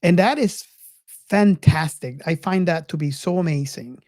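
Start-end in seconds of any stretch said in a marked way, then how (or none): none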